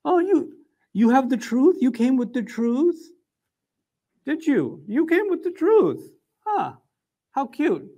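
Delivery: high-pitched, drawn-out mock wonder; condescending mockery. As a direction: tease him like a cute little kid. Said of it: "not gonna take him too seriously".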